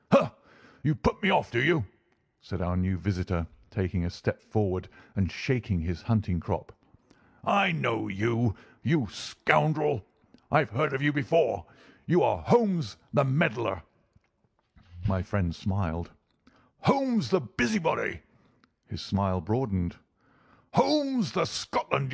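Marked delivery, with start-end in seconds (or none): 0.1-1.9 put-on high, indignant scoff of an old bully; angry old doctor. 7.4-13.8 put-on angry old doctor. 16.8-18.2 very high, contemptuous taunt; angry old doctor. Acted.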